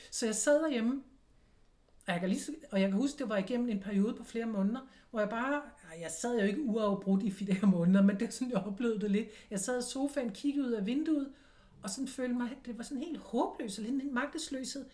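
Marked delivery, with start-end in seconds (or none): none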